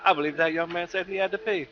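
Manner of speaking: a hint of a pompous announcer; mock formal.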